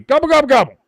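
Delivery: loud, high mock gobbling; turkey gobble. Host joking about playing Turkey, loud turkey imitation.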